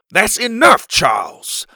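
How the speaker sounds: loud, firm interruption; impatient. A narrator's reading. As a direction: cut him off impatiently. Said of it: "Pa interrupted, growing impatient".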